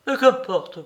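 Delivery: fiery lover's defiance; passionate outburst. A narrator's reading. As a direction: throw it out with fervour. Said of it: "Elle parlait avec une animation si extraordinaire".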